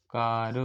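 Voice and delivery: कार with a drawn-out open "aa"; आ sound in कार. Shown as a demonstration. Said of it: "इसमें अ का क्या sound आ रहा है". (कार में खिंची हुई "आ" ध्वनि)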